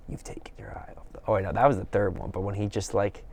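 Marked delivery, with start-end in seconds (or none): 0.1-1.2 unvoiced eerie whisper. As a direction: hiss it softly, menacing. Quoting Norman in mirror.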